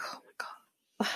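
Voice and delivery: barely audible, whispered aside; whispered retold reaction; hushed reaction. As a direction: breathe it out, overcome. Retold moved reaction to his words.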